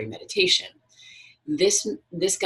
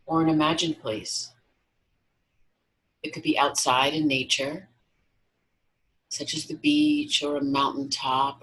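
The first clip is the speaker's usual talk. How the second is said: guided meditation. Acted. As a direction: guide them gently into relaxation, unhurried.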